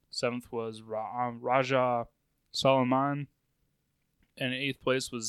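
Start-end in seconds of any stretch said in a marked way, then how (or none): none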